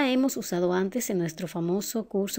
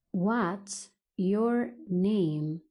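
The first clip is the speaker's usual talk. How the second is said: very slow, drawn-out teaching pace; word by word; much slower than usual. Shown as a demonstration. English teacher models asking a name, word by word. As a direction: stretch and separate each word for learners.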